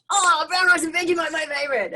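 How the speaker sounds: high, loud mock-gleeful cry; delighted exclamation. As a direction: laughingly re-enact a thrilled kid. Child's delight acted in retold memory.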